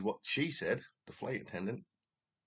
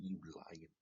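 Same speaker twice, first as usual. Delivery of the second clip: muttered insult; 2 semitones lower, 10 dB quieter than usual. Retold or imitated. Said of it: "I was like".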